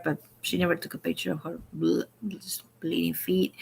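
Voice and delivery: hushed whisper, almost secret; whispered aside about her bleeding feet. Real, not acted. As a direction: drop to a confiding whisper.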